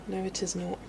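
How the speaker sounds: ordinary delivery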